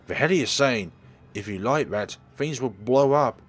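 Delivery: loud alarmed shout; panicked yell. Acted. Shouting at Jimmy that the gas will explode, panicked. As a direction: scream the warning at him.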